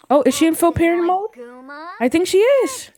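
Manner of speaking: high, loud, rising excited surprise; real excitement. Real, not acted. Player spots full parent mode in the game, excited. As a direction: voice leaps up in sudden excitement. Game goal suddenly seems reached.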